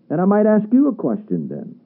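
ordinary talk, nothing marked

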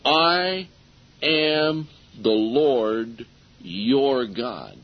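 each word drawn out slowly and weightily; slow emphatic ending. Shown as a demonstration. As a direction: lean on every word, very slow. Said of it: "Now notice how he ends every one of these".

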